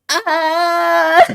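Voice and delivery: high, loud mocking shriek; mock scream. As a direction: let out a hammy fake scream. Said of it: "Oh shit".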